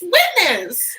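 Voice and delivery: high loud correction; shouted correction. Real, not acted. Correcting her cohost's misheard word, shouted.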